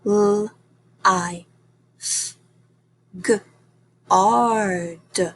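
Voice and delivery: lifeguard said as separate sounds L, I, F, G, R, D. Shown as a demonstration. Teacher checking answers, sounding out lifeguard slowly. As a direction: segment lifeguard one sound at a time with pauses. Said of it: "I should have heard".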